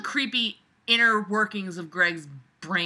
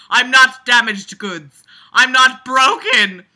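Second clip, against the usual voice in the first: hammed-up outburst; 4 semitones higher, 11 dB louder than usual. Hams up Abby's tearful line.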